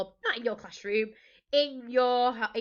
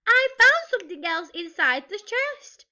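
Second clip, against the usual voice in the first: high, loud announcement; breaking news to the crew, high and loud; excited cry; 10 semitones higher, 8 dB louder than usual. A narrator's reading. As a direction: call out the discovery urgently. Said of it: "she cried".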